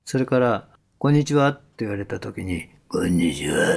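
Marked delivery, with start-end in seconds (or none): 2.9-3.8 「こんにちは」 said lower, dark and heavy; a gloomy, oppressive hello; dark oppressive greeting. Shown as a demonstration. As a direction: greet like you're weighing on them. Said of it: "暗く人を圧迫するような声よりも".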